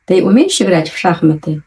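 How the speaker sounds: ordinary talk, nothing marked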